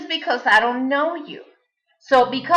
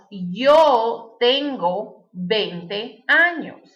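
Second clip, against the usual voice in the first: slow, carefully spaced Spanish sentence; slow teacher's model answer in Spanish; much slower than usual. Shown as a demonstration. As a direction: say the sample answer slowly and clearly. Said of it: "such as".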